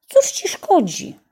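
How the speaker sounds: put-on high girlish voice. A narrator's reading. Sister urging the older brother, in a high girlish plea. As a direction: plead for your brother like a young girl.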